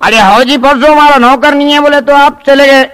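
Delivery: high, loud spoilt little nawab; bratty child voice. Acted. Naughty boy shouts at his tutor through the door, high and loud. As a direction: play the cheeky brat shouting from inside.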